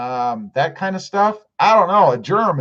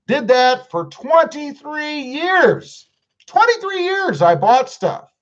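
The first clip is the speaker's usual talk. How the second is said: mock proud; 10 semitones higher, slower than usual. Jokey high put-on voice.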